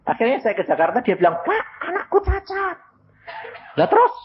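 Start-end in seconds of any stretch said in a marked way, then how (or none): 1.5-4.1 suara ibu tinggi sambil tertawa; ibu yang tertawa. Retold or imitated. Mother voiced, laughing. (meniru ibu dengan suara tinggi sambil tertawa)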